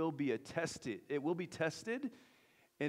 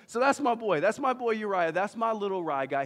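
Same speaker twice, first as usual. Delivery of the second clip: proud dad; 7 semitones higher, 9 dB louder than usual. Real, not acted. Proudly presenting his son.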